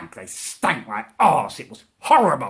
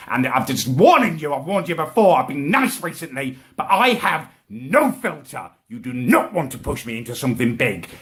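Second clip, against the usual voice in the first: normal talking voice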